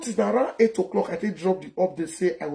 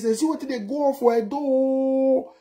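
high, drawn-out call with a long held last word; high sustained holler at ordinary volume; demonstrated call-out; 6 semitones higher than usual. Shown as a demonstration. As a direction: call it out like a rallying cry, stretching the end. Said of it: "shout like this".

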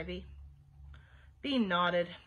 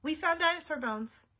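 higher, mildly raised declaration; loud voice; 5 semitones higher than usual. A narrator's reading. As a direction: announce it firmly. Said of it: "said Bean in a loud voice".

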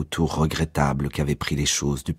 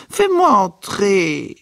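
higher, louder, slow drawn-out plea; mother insisting slowly at the door; insistent demand; 5 dB louder, much slower than usual. A narrator's reading. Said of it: "Madame Riley cognait contre la porte".

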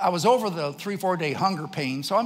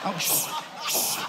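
imitated embarrassed shushing; urgent hushing; 3 semitones higher, slower than usual. Dad to crying son while people look, hushing. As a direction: shush a child in public.

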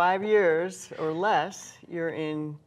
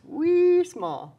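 much higher, louder squeak for wee small; tiny child voice; 13 semitones higher, 9 dB louder than usual. Acted. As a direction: squeal it like a little kid.

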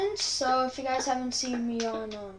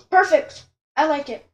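excited; 5 semitones higher, 13 dB louder than usual. Real, not acted. Thrilled with the result.

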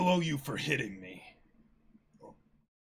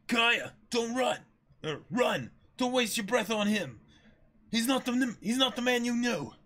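Usopp, frantic warning; 9 semitones higher than usual. Acted. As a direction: yell to stop her. S0 voices Usopp shouting warning.

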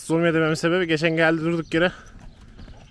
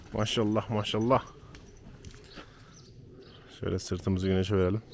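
ordinary delivery

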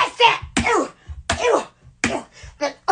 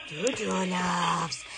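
slow low silly voice; 13 semitones lower, 7 dB quieter, slower than usual. Acted. Slow low stretched pet talk.

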